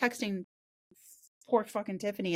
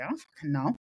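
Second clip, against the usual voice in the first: quoting herself; 6 semitones lower, much faster than usual. Retold or imitated. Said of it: "I was like".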